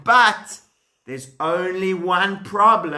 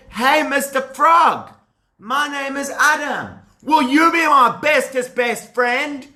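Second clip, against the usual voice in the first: eager Adam; 7 semitones higher than usual. Acted. Adam asks frog friendship.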